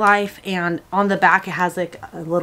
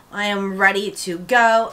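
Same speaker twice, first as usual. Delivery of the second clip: ordinary talk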